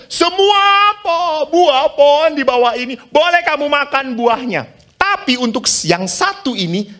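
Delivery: solemn high-pitched command from above; voice of God. Acted. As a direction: lift it high and proclaim like God. God's command voiced in sermon.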